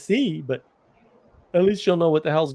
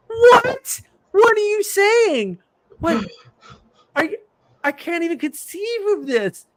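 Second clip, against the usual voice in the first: much higher, slow and incredulous; stunned disbelief; 5 dB louder, slower than usual. Retold or imitated. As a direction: drag out the what in astonished disbelief. Retelling reaction to machine gun story.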